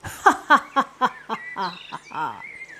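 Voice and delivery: long staged ha-ha laugh, dying away; hammed-up mocking laughter; acted belly laugh. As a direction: laugh like a gloating prankster. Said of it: "चरवाहा पेट पकड़कर हंस रहा था".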